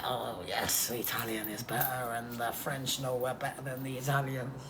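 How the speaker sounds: low, hushed put-on voice; soft, low mock one-upmanship; imitating rival nationals. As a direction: quietly play a self-satisfied rival. Voices French and Italian rivalry.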